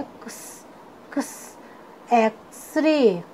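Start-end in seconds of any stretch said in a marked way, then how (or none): 0.0-1.6 क्स as a soft lone "ks" ending in a hiss; phonic letter sound. Shown as a demonstration. Teacher showing students the sound of X, phonic letter sound. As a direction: sound out क्स with hardly any vowel. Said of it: "एक्स का sound होता है".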